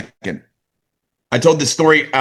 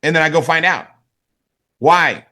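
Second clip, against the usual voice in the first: usual speaking voice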